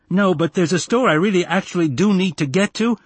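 put-on high, pleading boy; pestering kid begging a favor; insistent child. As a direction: whine for it, pitched well up.

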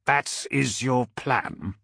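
slow, drawn-out scorn; slow, withering disbelief; scornful. A narrator's reading. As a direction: stretch each word with cutting contempt. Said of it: "The Primarch's scorn was like knives".

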